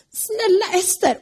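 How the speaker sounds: high, slow begging; high drawn-out plea; pleading. Acted. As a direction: beg for your life on your knees. Haman begs on his knees.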